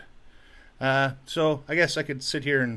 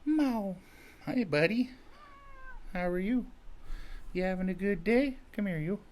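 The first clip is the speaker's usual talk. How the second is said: higher sing-song pet voice; doting cat-owner coo; 7 semitones higher, 4 dB quieter than usual. Real, not acted. Greeting the cat, cooing.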